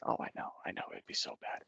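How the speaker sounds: unvoiced conspiratorial whisper. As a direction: whisper it secretly. Second voice whispering.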